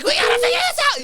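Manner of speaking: high, fast, laughing mock panic. Friend imitating panicking students, high hammed-up panic. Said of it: "like, panicking".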